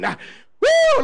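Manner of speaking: sudden high, loud "woo!"; preacher's whoop. Real, not acted. Preacher stirred by the church folks, high whoop. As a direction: let out a piercing church whoop.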